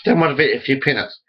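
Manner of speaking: usual speaking voice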